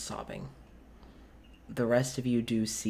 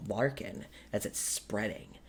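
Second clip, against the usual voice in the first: normal talking voice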